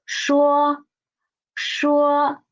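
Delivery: 说 held level, first tone; model pronunciation. Shown as a demonstration. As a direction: model 说 for repeating. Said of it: "Read after me, please".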